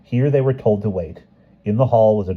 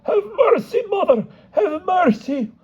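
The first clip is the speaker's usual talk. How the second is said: much higher, wailing plea for mercy; pleading cry. Acted. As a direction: beg the empress, overdone. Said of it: "cried out in one voice".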